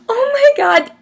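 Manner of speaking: high, breathless oh my God; starstruck gasp. Retold or imitated. Gasp after Louis waves.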